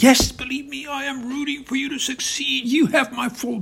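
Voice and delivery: high, insistent gushing; Putin, eagerly supportive. Acted. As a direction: pitch it high and keep pouring on support.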